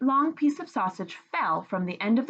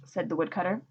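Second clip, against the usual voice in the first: ordinary delivery, nothing marked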